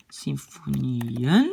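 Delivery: "symfonieën" very slow and low, long NIE, rising end; accent sur le I. Shown as a demonstration. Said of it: "l'accent est sur le I". (« symfonieën » très lent, NIE allongé)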